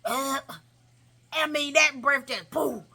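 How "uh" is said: short mock burp; acted little belch; imitated burp. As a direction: fake a burp in someone's face. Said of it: "right in front of my face and burp".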